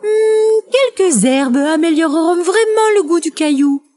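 voix de poule haut perchée; mère poule autoritaire. A narrator's reading. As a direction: demande un service, dans l'aigu. La poule réclame des herbes. (poule de conte à la voix aiguë)

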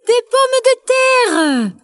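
cri perché, mots étirés, sans forcer le volume; exclame-toi très aigu, en traînant; exclamation de poule. A narrator's reading. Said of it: "s'exclama la mère poule". (mère poule qui s'exclame, voix suraiguë)

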